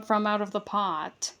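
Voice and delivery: plain reading voice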